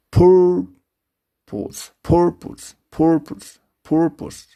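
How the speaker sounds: "purpose" high on the first syllable, stretched on the first take; long first vowel. Shown as a demonstration. Teacher models how to pronounce it, first syllable high and long. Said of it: "to pronounce w".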